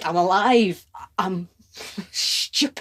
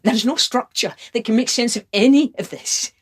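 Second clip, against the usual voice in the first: raised, higher outburst; exasperated passion; 5 semitones higher, 6 dB louder than usual. Acted. Lover finding no sense in love, loud and exasperated. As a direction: throw it out loudly in frustration.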